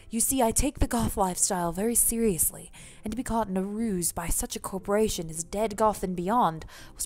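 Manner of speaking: gentlemanly put-on British accent; formal apology in a British accent. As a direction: speak as a proper British gentleman. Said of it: "Everyone's British".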